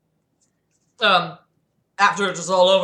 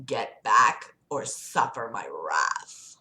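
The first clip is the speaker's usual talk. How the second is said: menacing devil; 9 semitones lower, 6 dB quieter, slower than usual. Acted. Lucifer threatening the angels.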